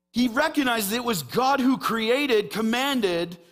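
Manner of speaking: high, loud, fervent preacher voice; impassioned sermon peak with hard-hit key words; full-force preaching. Real, not acted. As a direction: proclaim it boldly to the whole church.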